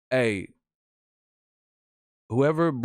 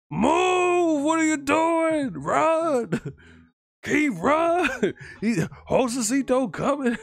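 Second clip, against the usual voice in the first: much higher, louder yells at the fighter; yelling at the screen; 13 semitones higher, 3 dB louder, slower than usual. Retold or imitated. Fan screaming at Keith on the screen, high loud yells. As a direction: scream at the TV like it can hear you. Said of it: "I be yelling at the screen".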